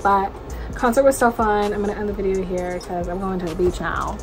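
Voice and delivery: ordinary talk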